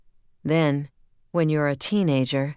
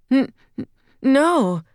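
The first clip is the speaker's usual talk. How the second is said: high, loud stuttered no; a scared child stammering under a shout; frightened stammer; 10 semitones higher, 7 dB louder, slower than usual. A narrator's reading. Terrified child answers angry father.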